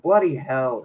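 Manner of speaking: plain reading voice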